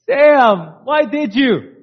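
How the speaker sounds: high, loud surprised question. Retold or imitated. Wife opening the door to flowers, surprised. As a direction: act delighted surprise.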